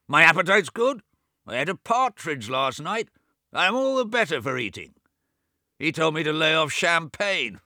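put-on high, loud, peevish old man; sick brother snapping at his deaf twin; testy old Swithin. A narrator's reading. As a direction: scold him like a cranky invalid. Swithin boasts of his health.

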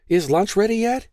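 put-on high, bright little girl's chirp; happy chirp. A narrator's reading. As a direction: chirp it eagerly like a young girl. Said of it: "she chirped with happy anticipation".

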